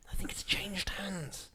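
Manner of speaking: hushed, drawn-out imitation; through gritted teeth. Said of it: "gritted teeth".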